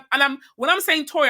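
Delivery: ordinary talk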